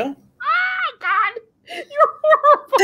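high, slow scandalised cry that breaks into laughter; laughing mock outrage at a friend; shocked laughing shriek. Real, not acted. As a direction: squeal in mock horror at the joke.

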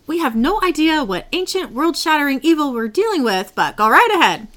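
high, exasperated imitation of a sarcastic rebuke; mock sarcastic scolding. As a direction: act out scolding a careless partner. Acting out Crowley's sarcastic scolding.

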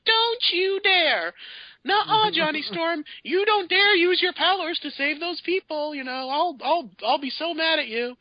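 put-on teenage shrew. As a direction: nag him like a shrill teen girlfriend. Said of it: "the teenage version of a shrew".

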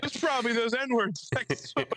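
high voice through laughter; laughing. Real, not acted. Laughing while quoting.

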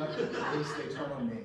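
low, soft mock-ominous confession; Blair Witch diary. Dad secretly filming his final diary, mock fearful hush. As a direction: murmur a last message to the camera. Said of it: "like a Blair Witch style video diary".

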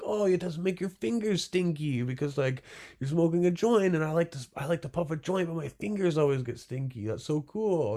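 high-pitched imitation of a keen smoker; delighted customer. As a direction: gush like a delighted buyer. Voicing impressed customers.

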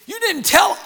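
friend's shocked question at the party; startled question. Retold or imitated. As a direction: blurt it out in disbelief.

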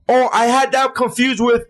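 high, slow retelling of his own line; mock realization. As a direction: play your own past self, high and slow. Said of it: "that I literally go".

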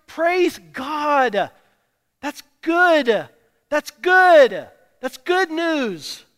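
loud, rising praise shout; exultant. Real, not acted. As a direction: call it out with joy. Preaching climax.

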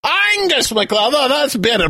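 normal talking voice

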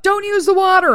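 high, loud mock of a curt order; mocking imitation. Mocking the mom who popped her head in. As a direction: ham up how bossy she sounded. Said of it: "pop your head in and be like".

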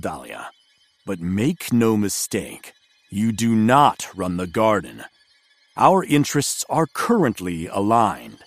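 disdainful envoy, low and level. Acted. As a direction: sneer at the headmaster. Envoy warns the leader.

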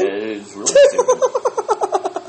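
high, slow laughing words. Real, not acted. Laughing at a silly point.

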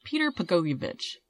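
"Peter Pagoyevich" stated with a falling end; settled pronunciation. Real, not acted. Host committing to the man's name, settled and decided. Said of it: "That's what I'm going with".

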